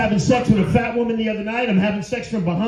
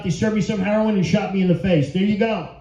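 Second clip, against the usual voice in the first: normal talking voice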